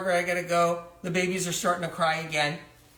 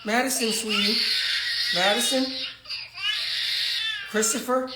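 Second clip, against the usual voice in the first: put-on high, strained calling of the baby's name; anxious calling; 6 semitones higher than usual. Mother calling to her screaming twins, high and strained. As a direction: call out to soothe the crying twins.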